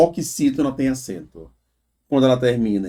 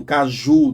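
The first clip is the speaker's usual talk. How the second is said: caju with JU stronger and drawn out; stress on final JU; 5 dB louder than usual. Shown as a demonstration. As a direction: lean on "ju" at the end of caju. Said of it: "demora mais no ju".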